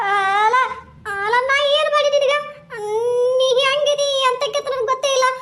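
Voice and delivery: high, pleading village woman voice, close to tears; frightened woman. Acted. A scared woman begging the ghost to leave her, high tearful plea.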